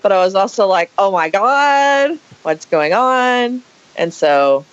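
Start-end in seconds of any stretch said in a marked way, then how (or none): 1.0-3.6 higher, slow half-laughing fright; excited by the spooky moment; mock spooked wonder; thrilled alarm. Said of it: "It excited me".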